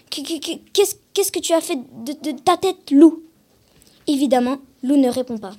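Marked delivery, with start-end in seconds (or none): 0.1-3.2 stammering, high, frightened question; shaky voice. Acted. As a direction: stutter it out, rattled, ending in a loud burst on the name. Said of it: "d'une voix un peu tremblante".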